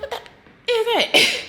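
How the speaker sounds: laughing mock fright; startled shriek. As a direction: jump at the dryer noise. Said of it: "I'm like".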